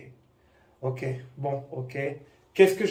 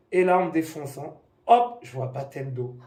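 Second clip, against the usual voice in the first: ordinary talk, nothing marked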